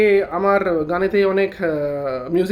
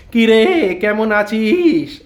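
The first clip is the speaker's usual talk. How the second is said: a louder greeting with the voice cracking; voice breaking; 4 dB louder, much slower than usual. Shown as a demonstration. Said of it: "এই যে গলাটা ভাঙলাম".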